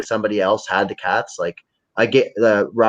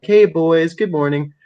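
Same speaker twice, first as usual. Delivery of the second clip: cheery greeting to cats; 6 semitones higher, 4 dB louder, slower than usual. Retold or imitated. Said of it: "I'll be like".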